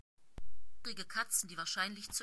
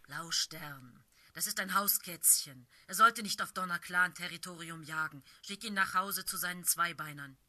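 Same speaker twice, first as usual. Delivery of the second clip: insistent warrior tomcat, louder; urgent tomcat; 2 semitones lower, 6 dB louder than usual. Acted. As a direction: warn the leader off the intruder. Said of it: "Sein tiefes Miauen war eindringlich".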